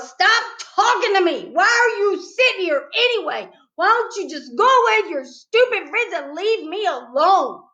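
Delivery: high, loud and fed up; angry shouting. A narrator's reading. Boy shouting at the pushy girl to go. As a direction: push her away with your voice.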